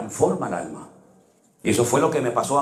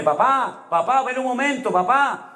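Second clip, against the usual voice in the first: high, pleading daughter voice calling papá; 12 semitones higher than usual. Retold or imitated. Sick daughter calling her father from bed. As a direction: call out like a confused sick girl.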